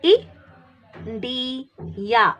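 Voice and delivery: "India" broken into "ih", "dee", "ya"; slow teacher breakdown, "E, D, ya"; syllable by syllable. Shown as a demonstration. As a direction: sound out each piece with a gap after it. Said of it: "this I has short sound".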